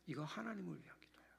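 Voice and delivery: a reverent hushed whisper. Acted. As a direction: whisper it, soft and quick. Revealing the third-floor prayer.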